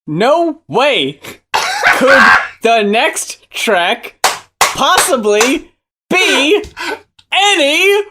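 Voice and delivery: slow, dramatic build, climbing high; mock disbelief. Setting up the next bad track, theatrical disbelief.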